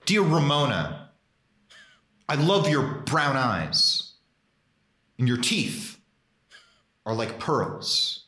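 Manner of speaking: slow, dramatic poetry slam reading with long pauses. Pretend. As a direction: deliver each line like a self-serious slam poet. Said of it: "recite it in your best, uh, slam poetry voice".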